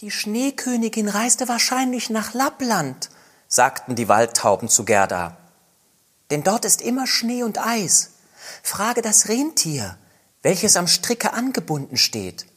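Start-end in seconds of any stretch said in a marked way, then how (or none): 0.0-3.1 very high, light bird voice; wood dove voice. Acted. Wood doves tell Gerda of Kai in high bird voices. 6.3-12.5 very high, light bird voice; wood dove voice. Acted. Wood doves tell Gerda of Kai in high bird voices.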